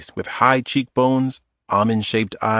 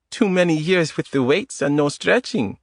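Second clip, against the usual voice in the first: put-on higher woman's voice with a light Eastern European accent; 6 semitones higher than usual. Adita teasing Tom in the grocery aisle, Eastern European accent. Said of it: "In a light Eastern European accent".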